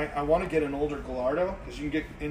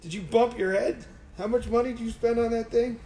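higher-pitched retold disbelief; imitating incredulous boss; 7 semitones higher than usual. Old boss questioning a car purchase, incredulous. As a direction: question him as if he's lost it. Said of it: "and he's like".

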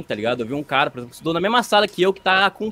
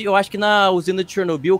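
normal talking voice

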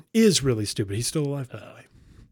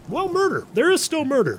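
high comic squeak, a bit louder; squeaky high voice; 14 semitones higher, 4 dB louder than usual. Acted. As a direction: shoot the voice way up on murder. Sudden squeak on murder.

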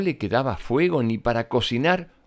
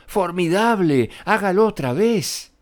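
higher, louder cheering cry; excited shout; 9 semitones higher, 4 dB louder than usual. Acted. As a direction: egg the dragon on with a high, loud cheer. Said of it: "Elizabeth gritó". (grito entusiasta y agudo)

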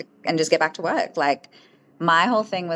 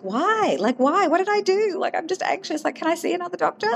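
high-pitched, incredulous protest; retold bewildered pleading; 13 semitones higher than usual. Patient asking why she can't see another doctor, bewildered pleading. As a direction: plead in a much higher voice, re-enacting it.

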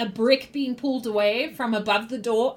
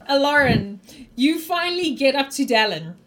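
ordinary talk

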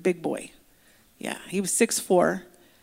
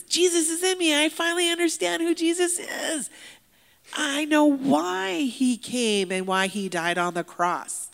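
high, elated outburst, louder at first; joyful proclamation; 8 semitones higher, 3 dB louder than usual. Retold or imitated. Inmate telling pastor Jesus is in him, jubilant. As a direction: exult like someone who finally understands. New convert rejoices, acted out.

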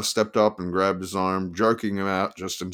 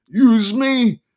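high, loud manic lawyer; excited manic attorney; 14 semitones higher, 7 dB louder than usual. Acted. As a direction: play the wild attorney, pitched up and loud. Attorney voiced, outraged.

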